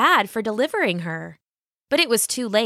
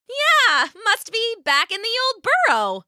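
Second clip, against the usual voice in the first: high, quick squeaky rabbit voice; bluffing rabbit; 14 semitones higher than usual. A narrator's reading. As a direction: fake a casual tone.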